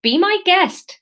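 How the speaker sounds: high, slow Somerset market seller; Somerset accent. Acted. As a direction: sing it out in a broad Somerset accent. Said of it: "this is gonna be Somerset".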